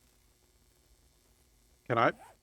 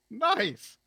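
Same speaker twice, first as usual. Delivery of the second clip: delighted shout; 11 semitones higher than usual. Real, not acted. Laughing reaction to chat.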